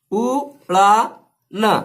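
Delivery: "उड़ाना" drawn out very slowly; slow sounding-out. Shown as a demonstration. Teacher sounding out "उड़ाना" very slowly for the class. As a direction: let every sound of "उड़ाना" ring out slowly. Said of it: "अब देखिए".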